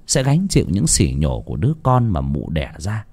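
ordinary delivery, nothing marked

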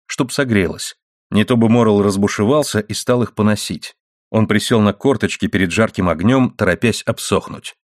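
ordinary delivery

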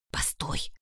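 whispered hiss in her ear. A narrator's reading. Сайрос stops Татьяна, urgent whispered hiss. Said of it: "зашипел на ухо Сайрос".

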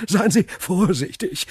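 high, laughter-broken warning; laughing. A narrator's reading. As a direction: speak through a chuckle, teasing. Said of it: "lachte Frau von Villefort". (lachend, mit hoher Stimme)

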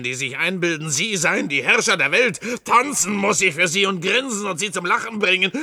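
bitter, building from talk to loud fury; rising bitter rant. Acted. Clown raging at his idle audience, building to fury.

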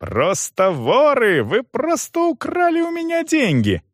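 very high, strained angry outburst; outraged accusation. A narrator's reading. Young man calls manager a thief, outraged. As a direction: hurl it, dragging the key words.

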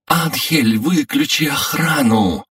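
put-on high girl's voice calling out; Remmy shouting. A narrator's reading. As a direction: call out high and urgent. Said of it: "кричала Рэмми".

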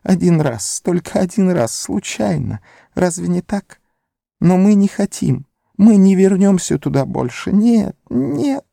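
high, whining creature voice; sagging Gollum. A narrator's reading. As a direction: wilt and whine it out. Gollum wilting, confessing.

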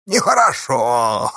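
drawn-out scolding wail; theatrical tut-tutting cry; reproachful cry. A narrator's reading. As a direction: slowly stretch it out in mock reproach. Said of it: "укоризненно закричал Чупря".

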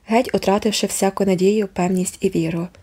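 ordinary delivery

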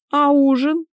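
high, loud surprised query; taken-aback question. Acted. Wife asks husband going to bed, high surprised question.

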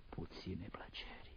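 whispered, resigned afterthought; hushed aside. Acted. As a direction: drop to a near-silent whisper. Bitter aside on life's few pleasures.

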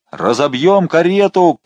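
rally the mob; crowd shout. A narrator's reading. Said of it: "раздались крики в толпе". (крики толпы)

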